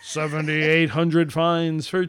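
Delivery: slow build, then an endlessly stretched name; hammed-up milestone proclamation; drawn-out announcer. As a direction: announce it with one very long vowel.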